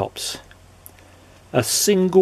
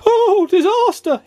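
slow, loud, very high wail; terrified scream; 6 dB louder, slower than usual. A narrator's reading. Mister Jelly seeing the tramp's face, terrified scream. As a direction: scream it in squeaky horror. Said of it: "he screamed".

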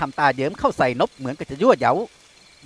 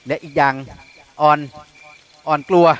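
halting and unsure, breaking off; a touch of nervous stammer; faltering; 4 dB louder than usual. A narrator's reading. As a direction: stumble over the words. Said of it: "หญิงสาวก็ตะกุกตะกักออกมา".

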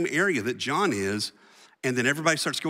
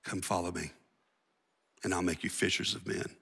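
much softer and lower, close and personal; soft, familiar invitation; 5 semitones lower, 6 dB quieter than usual. Retold or imitated. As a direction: invite old friends quietly. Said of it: "that dude shows up on the beach".

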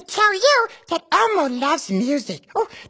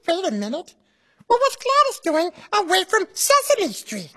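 Elmo voice. Acted. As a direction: speak as cheerful Elmo to a child. Elmo's voice throughout.

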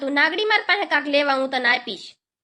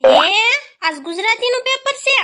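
shocked disbelief; 5 semitones higher, 4 dB louder than usual. Acted. Shocked again at exam news.

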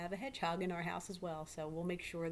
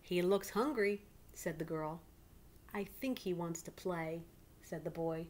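put-on lively read-aloud to children; story narration. A narrator's reading. Teacher reading a picture book to her class, lively.

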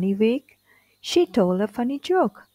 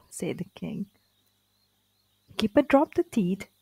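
ordinary delivery

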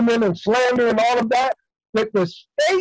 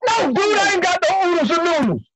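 high, quick, exasperated brush-off; 7 semitones higher than usual. Acted. Prisoner brushes off another man, exasperated. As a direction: snap back in a raised pitch. Turns on someone after hang-up.

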